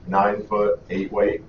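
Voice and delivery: ordinary talk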